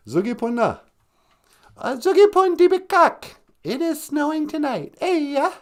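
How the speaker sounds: very high, squeaky character voice. Acted. A squeaky sidekick answers the host in a tiny high voice. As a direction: pipe it up like a little creature.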